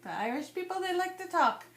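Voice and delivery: half-hearted Irish lilt, pitch lifting high at the end; badly faked Irish accent. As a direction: try an Irish accent and miss. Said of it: "that's not an Irish accent".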